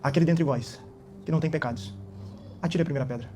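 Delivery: soft voice. Acted. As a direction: speak it softly and calmly, like mercy itself. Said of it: "eu imagino com a voz suave". (voz suave e baixa, cheia de mansidão)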